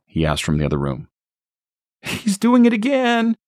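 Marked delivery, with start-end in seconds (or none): 2.0-3.4 high, alarmed report; frightened mother. Acted. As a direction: call it out, scared. Stacy calls to Harry.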